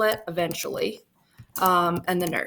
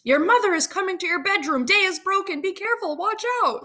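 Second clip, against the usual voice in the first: high, hurried alarm; urgent warning; 11 semitones higher, faster than usual. Acted. Nurse warns the lovers that mother is coming, urgent. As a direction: warn them fast and high-pitched.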